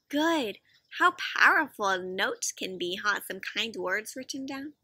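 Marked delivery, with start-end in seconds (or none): 3.3-4.7 soft hushed aside; whisper. Real, not acted. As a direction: breathe it like a secret.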